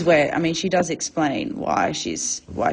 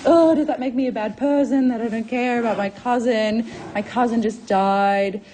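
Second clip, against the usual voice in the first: high, whiny mock meltdown; Juliet in emotional crisis; 5 semitones higher than usual. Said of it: "emotional crisis, being like".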